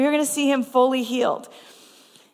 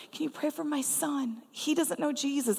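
soft, higher pleading voice; imitated tearful plea; 5 semitones higher, 6 dB quieter than usual. Mother asking prayer for her son, mildly tearful. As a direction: mildly act out a weeping request, hushed. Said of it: "she starts weeping and weeping".

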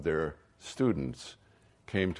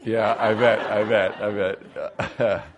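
laughing, louder and higher; 4 semitones higher, 9 dB louder than usual. Real, not acted. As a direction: laugh it out, amused. Laughs at the joke from S2.

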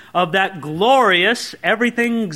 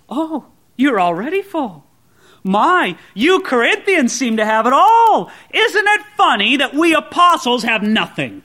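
mock admiration, a bit higher; sarcastic; 6 semitones higher, 6 dB louder than usual. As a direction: tease them with false praise. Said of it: "Paul is using some strong sarcasm".

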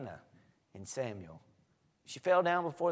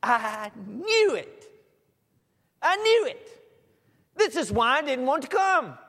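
much higher, loud, petulant complaining; whiny big baby; 11 semitones higher, 8 dB louder than usual. Retold or imitated. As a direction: whine at God like a sulking child. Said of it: "Jonah's prayer, his speech to God".

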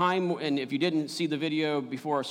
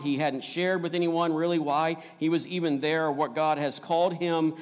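ordinary talk, nothing marked

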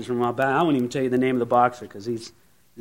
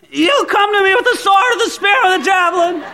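put-on much higher, loud cracking scream of a boy; teen voice cracking; 7 dB louder than usual. Teenage David screaming at Goliath, voice cracking. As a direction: scream it like a teenager whose voice breaks. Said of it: "he screams with his voice cracking".

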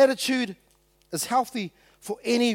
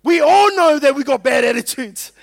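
higher, much louder preaching shout; loud, raised-pitch declaration to the whole room; emphatic outburst; 6 semitones higher, 10 dB louder than usual. Real, not acted. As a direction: burst out as if exposing everyone. Preacher's sudden climax after calm list.

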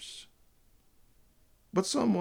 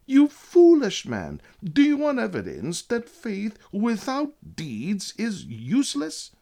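loud, high, forceful quote reading; emphatic scriptural rebuke; 8 semitones higher, 6 dB louder than usual. Acted. Preacher quoting James against faith-alone believers, emphatic rebuke. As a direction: declaim it as a stern rebuke, raised in pitch and volume.

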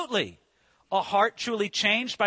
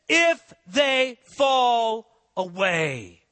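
"away" slowed and weighted, the phrase drawn out; emphasis on away; 3 dB louder, much slower than usual. Shown as a demonstration. Preacher stressing scripture to congregation, slow emphatic read. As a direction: lean hard on "away", slow. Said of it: "I put emphasis on that".

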